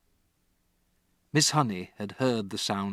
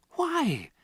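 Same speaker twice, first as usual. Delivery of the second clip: put-on high child's plea; pleading girl. Matilda to Miss Honey, high plea. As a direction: demand an answer. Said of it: "Matilda cried".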